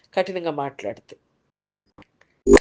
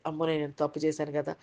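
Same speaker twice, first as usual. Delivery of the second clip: low, soft, quick fretting; understated worry, hurried and hushed; anxious inner thought; 6 semitones lower, 8 dB quieter than usual. Acted. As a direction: voice a worried thought under your breath. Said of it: "ఇలాంటి ఆలోచనలు వస్తాయి".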